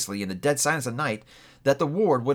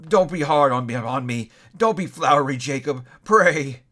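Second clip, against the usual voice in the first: louder, higher panicky begging; frightened plea; 4 semitones higher, 5 dB louder than usual. A narrator's reading. Scrooge begs the ghost not to be harsh, frightened. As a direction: plead with rising fear.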